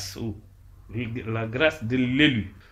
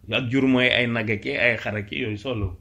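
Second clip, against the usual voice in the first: ordinary talk, nothing marked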